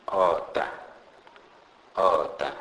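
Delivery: "oughta" with O as in "ought"; example word demo. Shown as a demonstration. Teacher gives a Sepedi example, "oughta" with an open O. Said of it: "pronounced like the O in the word ought".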